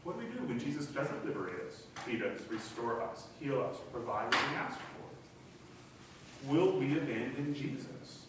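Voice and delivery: usual speaking voice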